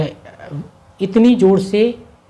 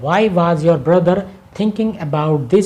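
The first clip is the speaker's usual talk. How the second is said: slow dictation; much slower than usual. Shown as a demonstration. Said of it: "teacher reads translation".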